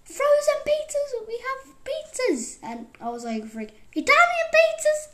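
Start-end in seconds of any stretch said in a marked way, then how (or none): 0.0-2.6 high, loud mock yell; imitated excited yell. Mum announcing frozen pizzas to the house, mock yell. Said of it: "she would always yell". 3.5-5.1 very high, loud re-enacted outburst; acted excited yell. Child reacting to mum's pizza news, acted excited yell.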